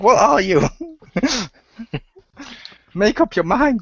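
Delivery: high-pitched incredulous complaint; mock exasperation. Podcaster scolds fickle WWE Universe, high mock exasperation.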